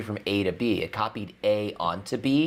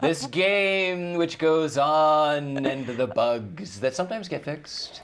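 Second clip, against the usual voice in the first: slow dramatic; 4 semitones higher, slower than usual. Pretend. Mock dramatic soap intro.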